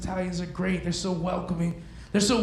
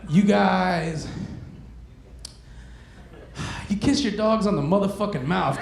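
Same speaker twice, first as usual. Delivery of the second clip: ordinary talk, nothing marked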